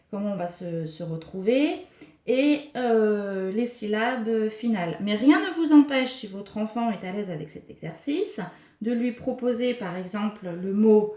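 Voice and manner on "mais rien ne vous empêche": ordinary talk, nothing marked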